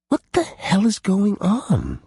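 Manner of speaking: high, slow, blunt question; blunt, slowed demand. A narrator's reading. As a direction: ask it straight out, slowly. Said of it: "Pippa asked bluntly".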